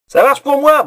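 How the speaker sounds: loud bawled shout from the next room; bawling. Acted. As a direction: bellow it out high and loud. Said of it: "brailla Herda". (brailler fort)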